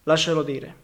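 ordinary delivery

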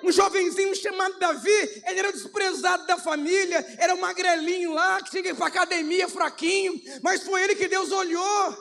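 loud, fervent pulpit preaching; preaching at full force. Acted. Pastor stirring his congregation, loud fervent preaching. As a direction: preach it out, building the fire.

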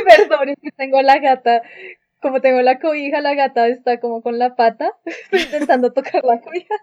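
laughing. Real, not acted. Cat distracting her, laughing.

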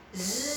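steady soft buzzing zzz; bee voice. Shown as a demonstration. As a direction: buzz like a bee. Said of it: "seperti lebah".

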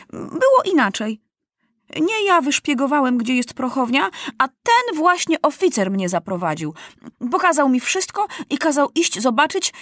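put-on high-pitched boy's voice; boldly speaking boy. A narrator's reading. Accused boy answering the court, bold. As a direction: argue back boldly, voice up high. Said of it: "ciągnął śmiało Maciuś".

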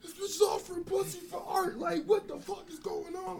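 high and loud, slowing on the last line; screaming at the screen in disbelief; mock scream. At the computer screen, screaming in disbelief. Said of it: "just screaming like".

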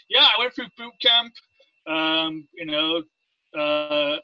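usual speaking voice

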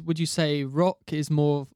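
usual speaking voice

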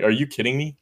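ordinary talk, nothing marked

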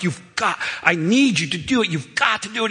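urging. Retold or imitated. Father urging son under drywall.

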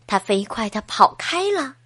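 plain reading voice

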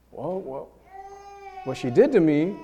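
ordinary delivery

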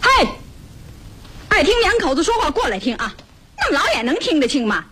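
high-pitched taunting holler. Acted. Woman hollering at a policeman watching from afar, taunting. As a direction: challenge a distant eavesdropper, pitch way up. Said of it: "虎妞冲着那个人就喊".

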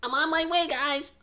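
very high, small rodent voice; high-pitched cartoon critter; little rat. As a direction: squeak it out as a tiny rat. Voicing Sneezy the rat.

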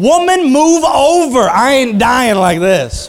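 loud, high, half-laughing yell; pretend Jack yelling. Preacher as Jack demanding room on the raft, laughing. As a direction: bark it out like a joking Jack. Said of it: "If I was Jack, I'd been".

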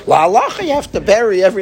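imitated insistent arguing. Study partner insisting on the law, mock argumentative.

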